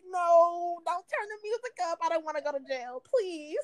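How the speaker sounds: high, whiny begging voice; mock pleading. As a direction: beg them not to turn it up. Pleading not to go to jail.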